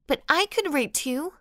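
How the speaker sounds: high whiny protest; whining. A narrator's reading. Sandra whining back at the teacher, high and petulant. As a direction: whine it back like a spoiled girl. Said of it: "Whining as well".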